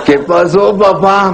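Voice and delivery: put-on high, hurt little boy asking his dad; high-pitched hurt child's question; childish whine. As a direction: squeak it up like a stunned child. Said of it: "yo dije".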